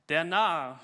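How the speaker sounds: loud, scornful cry of the witch; witch's shout. Acted. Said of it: "schrie sie".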